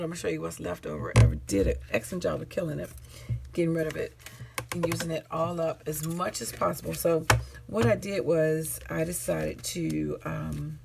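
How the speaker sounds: normal talking voice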